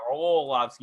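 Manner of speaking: "Arlovsky" said as ar-OH-lovsky; wrong O in Arlovsky. Shown as a demonstration. Host demonstrating the mistake, "Arlovsky" with long O. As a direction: put a long "oh" after the first syllable of "Arlovsky". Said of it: "you're saying it wrong".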